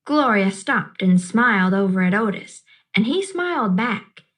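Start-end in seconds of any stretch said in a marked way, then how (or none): none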